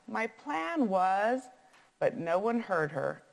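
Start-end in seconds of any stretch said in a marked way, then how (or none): 0.1-1.4 slow, higher, mildly exasperated; sighing. A narrator's reading. Missus Comfort tries to explain her plan, sighing slowly. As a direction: draw it out with a touch of a sigh. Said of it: "Missus Comfort sighed".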